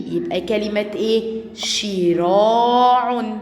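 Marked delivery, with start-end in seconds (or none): 1.5-3.4 شراع very slowly as "shi-raa-'un"; drawn-out example word. Shown as a demonstration. Teacher answers her own question for pupils, drawn-out شراع. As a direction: stretch شراع with a long held "raa". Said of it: "تحتيه كسرة".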